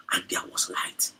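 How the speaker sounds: slow, breathy whispered aside; hushed whisper. Acted. Preacher narrating creation in an awed whisper. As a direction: whisper it in awe.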